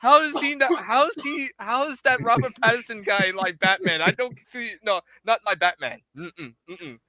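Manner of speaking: high-pitched mock Saudi accent. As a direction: play the Saudi prince in a thick Arabic accent. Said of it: "you have better Saudi accent".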